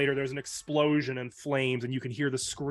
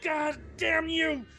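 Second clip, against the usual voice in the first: screaming; slower than usual. Acted. Struggle over the torch.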